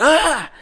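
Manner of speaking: high, loud startled yelp imitated; startled shout. Retelling a startled local's cry to friends, loud yelp. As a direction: jump out of your skin with a cry. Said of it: "Like that, I swear to God".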